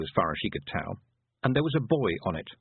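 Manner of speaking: plain reading voice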